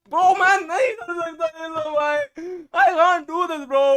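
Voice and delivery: high, loud giving up; exasperated outburst. Real, not acted. Streamer gives up on doing accents, exasperated outburst. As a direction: burst out loudly at a high pitch.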